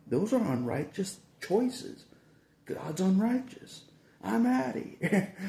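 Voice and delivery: high, loud, slow declaration; indignant walkout. Retold or imitated. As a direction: announce you're quitting, outraged. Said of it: "I'm like".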